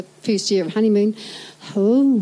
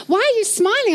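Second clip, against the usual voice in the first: puzzled customer; 9 semitones higher than usual. Retold or imitated. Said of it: "I'd be serving them".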